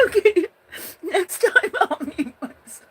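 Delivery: very high, loud laughing speech. Real, not acted. Streamer laughing, promising viewers to mute next time. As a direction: laugh through the words, can't stop. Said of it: "I can't stop, guys".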